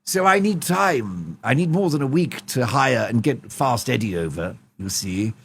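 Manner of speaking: posh fussy king in a voice note, each you see trailing low; King Charles impression. King leaving Harry a voice note, posh and fussy.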